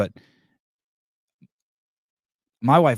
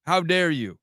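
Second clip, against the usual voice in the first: mock indignant; 9 semitones higher, slower than usual.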